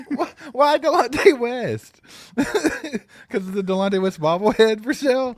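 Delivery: high-pitched, breaking into giggles; laughing. Real, not acted. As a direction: ask it while cracking up. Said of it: "You're running the show".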